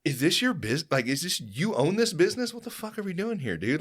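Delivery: high-pitched, indignant questioning; retold heated challenge. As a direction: confront him in exasperation, as you retell it. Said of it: "I was so heated".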